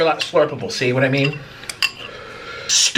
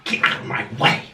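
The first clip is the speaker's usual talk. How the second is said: whispered; 4 semitones lower, 3 dB quieter than usual. Real, not acted. Dropped aside.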